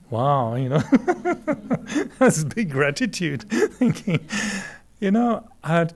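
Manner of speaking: real laughter through grateful words; laughing. Real, not acted. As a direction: laugh high and bright through it. Moved by dentist's work, laughing.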